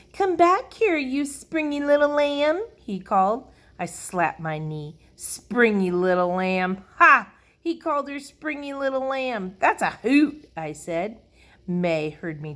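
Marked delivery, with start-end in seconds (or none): 0.1-2.6 high, loud sing-out; playful calling. A narrator's reading. Sheldon calls playfully after skipping Lucille, loudly. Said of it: "Sheldon was calling to her". 5.2-10.5 high, loud scoffing glee; amused mockery. A narrator's reading. Junie B. makes fun of Sheldon's pet name, gleefully.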